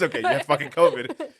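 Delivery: loud, laughing through the doctor's verdict; doctor's blunt line laughed out loud; laughing quote. Retold or imitated. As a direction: crack up while quoting the doctor.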